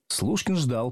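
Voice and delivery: ordinary delivery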